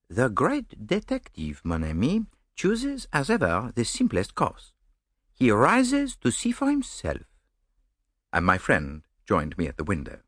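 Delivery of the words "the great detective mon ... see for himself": fussy, self-satisfied Belgian detective, slow; Poirot savouring his own greatness, slowly. Acted.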